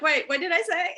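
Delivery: amused at her own slip; laughing. Retold or imitated. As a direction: giggle as you catch yourself. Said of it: "now I'm like".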